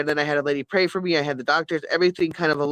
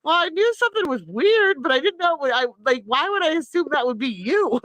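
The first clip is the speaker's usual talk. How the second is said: much higher, indignant retelling; high retold protest; 10 semitones higher than usual. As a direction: defend yourself to your daughter, pitched up. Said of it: "And I went".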